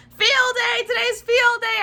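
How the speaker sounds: high, loud joyful shouting; happy shout. Acted. Junie B. shouting happy news to her dog, loud. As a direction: shout the news with pure glee. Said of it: "I shouted to my baby brother".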